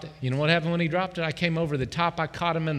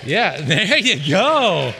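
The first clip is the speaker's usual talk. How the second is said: loud slow laugh; 6 dB louder, much slower than usual. Real, not acted. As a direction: laugh it out with delight. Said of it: "Anybody happy".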